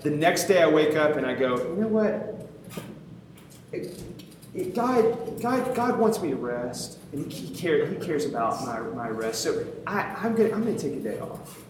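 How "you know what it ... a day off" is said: halting, stumbling mock excuse; self-justifying. Talking himself into a day off, mock self-justifying. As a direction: fumble your way into a convenient excuse. Acting out excuse to skip reading.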